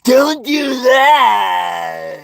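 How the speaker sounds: very high, loud, long-drawn cry; mock wail. Mimicking those who forbid freedom, hammed-up wailing shout. Climax of mocked warning.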